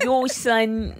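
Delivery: mock New York "Yo, son"; New York accent. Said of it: "Like, hard".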